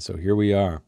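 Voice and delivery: ordinary talk, nothing marked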